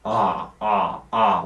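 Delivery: "Ah, ah, ah" sung low and open. Shown as a demonstration. Teacher demonstrating open ah sounds. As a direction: find the open ah. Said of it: "I is a good example".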